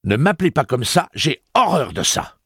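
high, loud outburst; woman flaring up at Eddie, furious; violent reaction. A narrator's reading. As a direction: lash out at the name. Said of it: "Sa réaction avait été si violente".

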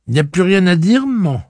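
put-on high, lively girl voice; a daughter answering her mother; young girl voice. Maria speaks to mother.

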